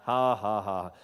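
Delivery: low mock "ha, ha, ha"; forced laugh. Man answering the devil's question, forced low laugh. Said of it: "I'm laughing at you".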